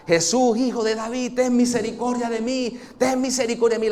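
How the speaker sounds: blind beggar crying out. Acted. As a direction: act out the desperate cry to Jesus. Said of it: "Jesús gritando, yo me imagino aquella escena".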